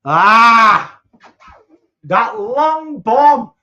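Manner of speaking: high, loud, stretched cry of delight; excited shout. Real, not acted. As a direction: roar "That long bomb!" slowly as the ball sails through. Reacting to a long goal.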